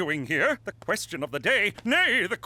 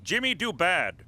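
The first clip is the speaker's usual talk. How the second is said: dumbed-down talk; 4 semitones lower than usual. Acted. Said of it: "Speak American".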